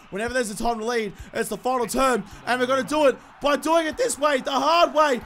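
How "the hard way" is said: ordinary talk, nothing marked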